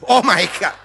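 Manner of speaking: sudden loud "oh my God"; mock shock. Mock shock at the tumor diagnosis.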